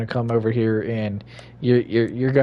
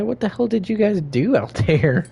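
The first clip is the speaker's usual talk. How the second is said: high put-on voice; 9 semitones higher than usual. Voiced at panting character.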